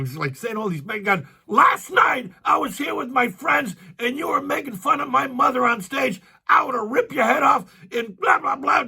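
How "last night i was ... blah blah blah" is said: retold screaming. Stranger screams at the comic about his mother, mock shouting. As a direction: yell it like an offended son spoiling for a fight. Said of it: "He's, like, screaming at him".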